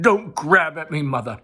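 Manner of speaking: loud, snapping at her; sharp angry. Acted. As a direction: shake her off. Son shakes her off.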